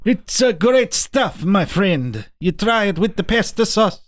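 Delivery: mock Italian chef voice; Italian accent. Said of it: "Is that your Italian accent?".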